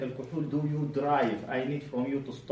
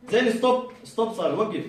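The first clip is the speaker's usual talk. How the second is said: loud sharp command to stop; firm stop; 5 semitones higher, 5 dB louder, faster than usual. Real, not acted. Doctor telling the patient to stop resisting, loud. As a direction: call a clear halt.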